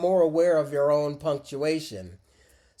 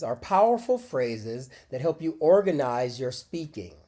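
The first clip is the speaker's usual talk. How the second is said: ordinary talk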